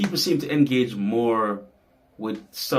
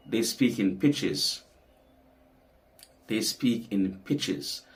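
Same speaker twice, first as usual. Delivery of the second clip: slow emphasis; slower than usual. Real, not acted. Slow repeated key point of lesson.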